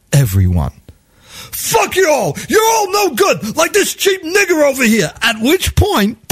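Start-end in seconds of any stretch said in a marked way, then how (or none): 1.5-5.1 furious yelling. Retold or imitated. Panhandler raging at the whole subway car.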